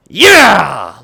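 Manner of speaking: loud triumphant shout; victory yell. Real, not acted. Gamer cheering as his team wins the series, loud. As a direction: cheer the win.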